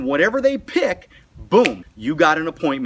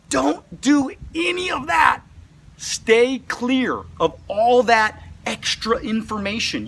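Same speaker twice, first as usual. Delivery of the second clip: slow, higher shout of warning; stern warning; 6 semitones higher, slower than usual. Real, not acted. Coach warning recruits off the bad pitch, a slow shout.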